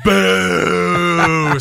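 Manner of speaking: long drawn-out boo; imitated crowd boo. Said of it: "greeted by a chorus of boos".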